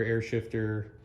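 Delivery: ordinary talk, nothing marked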